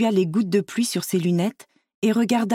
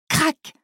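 short high-pitched crack; imitated tree crack; 9 semitones higher than usual. A narrator's reading. Storyteller as a tree starts falling, short high crack. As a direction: snap the word out high, like breaking wood.

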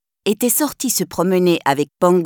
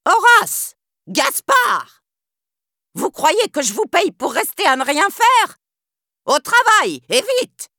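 Cruella screaming; 9 semitones higher than usual. Acted. Cruella to her lazy henchmen, furious screaming. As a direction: berate them like a tyrant. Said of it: "perturbée par les hurlements de Cruella".

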